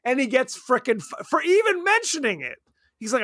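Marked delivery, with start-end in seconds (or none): none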